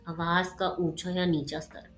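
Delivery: mildly sad, subdued reading. Shown as a demonstration. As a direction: read it a little downcast. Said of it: "या फिर दुखी होके". (हल्के दुखी लहजे में)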